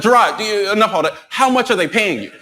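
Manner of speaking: high-pitched imitation of a friend cutting in; mock-exasperated friend demanding an answer; interrupt, pitched high, and insist; impatient homegirl. Homegirl cuts him off impatiently.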